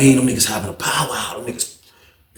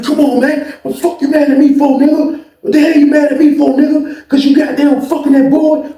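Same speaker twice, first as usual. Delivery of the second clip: high, loud mock pleading mid-beating; agitated protest; 14 semitones higher, 6 dB louder than usual. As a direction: act out a heated protest at full pitch. Acted quarrel during beating.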